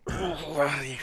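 pained groans over a leg cramp; talking through a cramping hamstring. Real, not acted. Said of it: "a very strong hammy cramp".

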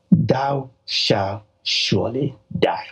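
slow, each word of "thou shalt surely die" set apart; weighty, drawn-out decree; emphatic. Retold or imitated. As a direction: pronounce it as an inescapable sentence. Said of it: "God emphatically said".

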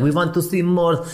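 a playful put-on European accent; a filmmaker retelling a German tourist's praise in a mock European accent; vague European accent. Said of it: "I don't know what accent I'm doing".

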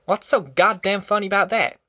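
irritable. A narrator's reading. Tom snapping at the giggling stranger, irritated. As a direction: demand an answer, fed up. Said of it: "Tom turned irritably".

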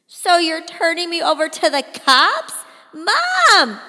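loud, high accusing cry ending in a call; betrayed teen yelling for help; desperate outcry. A narrator's reading. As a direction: fling it at him, then shout for Mom. Daughter confronting father, calling mother.

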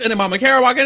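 normal talking voice

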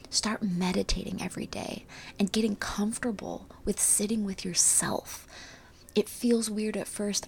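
soft breathy encouragement; hushed, gentle, inviting; airy breathy mystical healer. Acted. Gentle healing advice.

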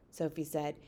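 ordinary delivery

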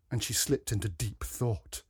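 plain reading voice

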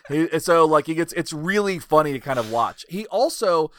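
ordinary talk

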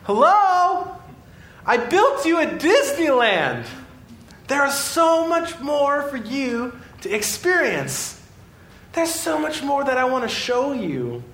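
God calling out. Acted. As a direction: wake them up with an excited hello. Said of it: "God is saying".

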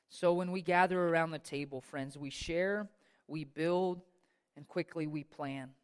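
ordinary delivery

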